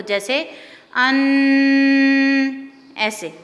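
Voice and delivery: अन with the sound carried through the nose; nasal ghunna demo. Shown as a demonstration. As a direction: hum अन nasally. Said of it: "गुन्ना कहते हैं नाक में आवाज़ लेकर जाने को". (अन को नाक में आवाज़ ले जाकर पढ़ें)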